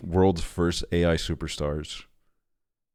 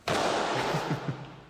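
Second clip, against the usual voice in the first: laughing; 8 semitones higher, 6 dB quieter than usual. Real, not acted. Laughs at the video.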